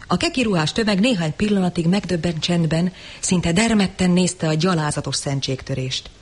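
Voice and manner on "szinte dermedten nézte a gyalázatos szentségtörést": usual speaking voice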